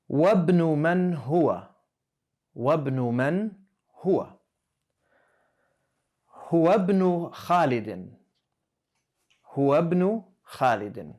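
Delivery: clear, measured Arabic reading; reading aloud for class. Shown as a demonstration. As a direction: read it out for the students to hear. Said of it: "I'm gonna go ahead and read it".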